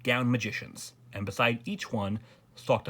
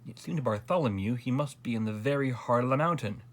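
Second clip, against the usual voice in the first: ordinary delivery, nothing marked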